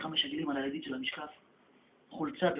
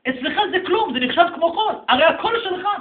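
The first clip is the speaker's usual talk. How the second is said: higher and louder, with a laugh; incredulous; 11 semitones higher, 14 dB louder than usual. Acted. The people ask God why he cries over sand, incredulous. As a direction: scoff at God weeping over money.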